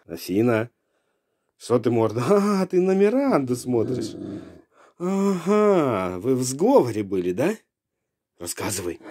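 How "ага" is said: ordinary delivery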